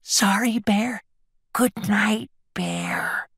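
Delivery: tiny high mouse whisper. A narrator's reading. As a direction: whisper a timid apology and goodnight. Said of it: "whispered Mouse".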